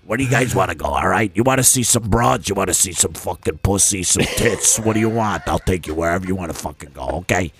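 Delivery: low put-on Brooklyn accent, swaggering; Brooklyn driver voice. Friend imitating Vegas Phil greeting them, in a low Brooklyn accent. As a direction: play the pushy New York limo driver. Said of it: "he talks like this".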